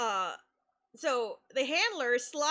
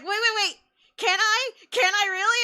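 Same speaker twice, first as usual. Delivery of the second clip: excited disbelief; 8 semitones higher, 8 dB louder than usual. Retold or imitated. Fan retells Graven's excited reaction.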